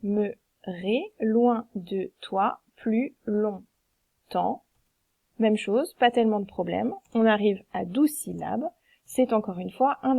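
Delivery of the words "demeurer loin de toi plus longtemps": very slow, each syllable separated; scanning the line syllable by syllable. Shown as a demonstration. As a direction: tap out every syllable slowly. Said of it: "On fait le, le même découpage".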